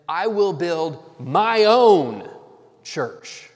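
slow, loud, with "own" swelling and "church" dropping to a breath; slow emphatic reading, "own" loudest; stress on own. Shown as a demonstration. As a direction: stretch and punch "own" in "my own church". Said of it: "emphasizing who the church".

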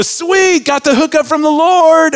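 high, loud delighted mock voice; gleeful over a freebie, hammed up. Preacher playing a Chipotle customer, mock delight. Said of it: "walk out in that moment and go".

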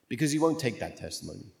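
ordinary talk

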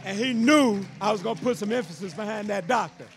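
proud, loud praise. Real, not acted. Proud host raising voice.

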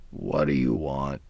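lightly voiced old grandmother; grandmother's voice. A narrator's reading. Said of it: "she asked him".

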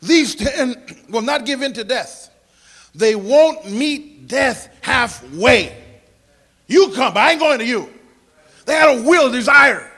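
loud, emphatic preacher delivery; defiant, high-energy preaching; forceful preaching. Real, not acted.

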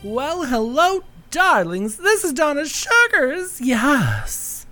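high, drawn-out drag diva greeting; campy drag queen. Pretend. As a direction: greet the darlings like a drag star. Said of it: "this voice".

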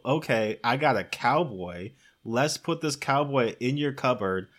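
ordinary talk

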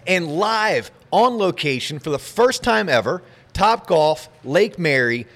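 big showman announcer intro; ringmaster. Acted. Podcast opener in showman style.

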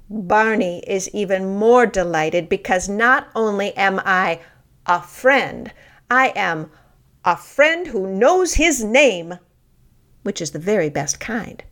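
usual speaking voice

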